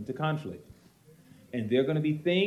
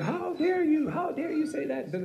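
much higher, indignant pastor voice; angry pastor. Retold or imitated. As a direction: play the outraged pastor. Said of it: "he's just like".

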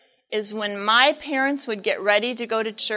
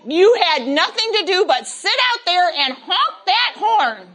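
exasperated scolding; 7 semitones higher, 5 dB louder than usual. Retold or imitated. Mother scolds father.

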